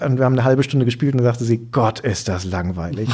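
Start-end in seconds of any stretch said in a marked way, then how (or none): 1.7-3.1 slow, bored and dismissive; bored sigh. Retold or imitated. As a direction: drawl it out like you're utterly bored. Frau zitiert, gelangweilt nach Spiel. (gelangweilt und abschätzig)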